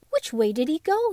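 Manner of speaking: eager, breathless dog; excited. Acted. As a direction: blurt it out on the hunt.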